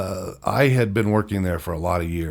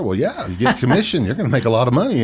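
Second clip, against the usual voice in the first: higher, louder retold enthusiasm; eager sales pitch; 4 semitones higher, 5 dB louder, faster than usual. Boss selling him on a sales job, eager retold pitch. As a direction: talk up the big money, pitched up.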